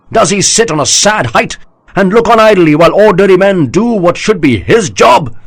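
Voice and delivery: high loud oratory, slowing at the end; rousing speech. A narrator's reading. General rallying his army, loud rousing oratory. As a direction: stir the troops with every word.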